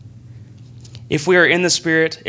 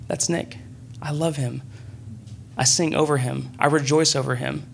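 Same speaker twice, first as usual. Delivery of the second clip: tender fatherly voice; 7 dB quieter than usual. Pretend. God voiced speaking of Nick.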